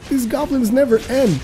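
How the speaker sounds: exasperated gamer. Retold or imitated. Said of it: "And I'm just like".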